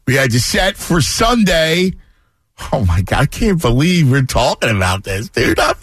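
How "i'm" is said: high-pitched excited outburst; beside yourself with excitement; real excitement. Real, not acted. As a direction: burst out in a giddy, much higher voice. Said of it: "I'm out of my mind".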